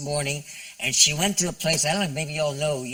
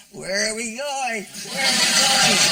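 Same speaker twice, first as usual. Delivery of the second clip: put-on confused old husband; 8 semitones higher than usual. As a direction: lightly play the muddled old husband in the wheelchair. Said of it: "you're gonna be saying".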